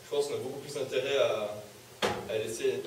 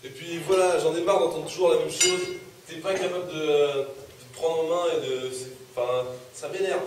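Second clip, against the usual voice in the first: loud, exasperated parental outburst; fed-up anger; 3 semitones higher, 7 dB louder than usual. Acted. Father is sick of the son's excuses, exasperated. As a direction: raise your voice, faltering at the end.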